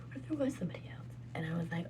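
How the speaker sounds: unvoiced hushed musing; whisper. Real, not acted. Wondering aloud about someone else in a whisper. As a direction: breathe the question softly.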